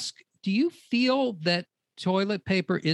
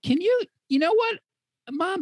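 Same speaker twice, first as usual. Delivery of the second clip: put-on teen voice; 12 semitones higher than usual. Teen role talking back.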